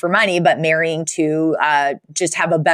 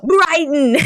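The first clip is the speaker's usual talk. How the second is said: mock alarmed cry; 8 semitones higher than usual. Parents gasp realizing the danger.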